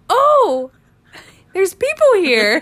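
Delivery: high, loud startled discovery; drunk surprise. Retold or imitated. Drunk girl peeking into the kitchen, high loud surprise. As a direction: gasp it out like you just found a crowd.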